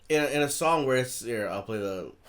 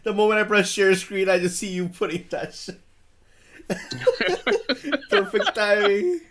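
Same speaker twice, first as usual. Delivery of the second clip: laughing; 9 semitones higher, 8 dB louder than usual. Real, not acted. Catches cohost eating on screen.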